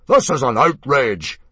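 loud, slow indignant boom; booming outrage. A narrator's reading. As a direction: thunder it out, affronted. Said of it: "boomed Mogadon".

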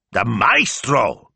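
high, drawn-out incredulous burst; scandalised exclamation; indignant outburst. A narrator's reading. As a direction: burst out in outrage, stretching the words. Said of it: "Signor Speranza burst out".

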